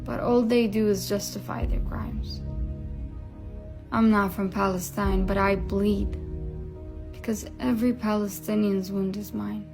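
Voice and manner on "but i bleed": normal talking voice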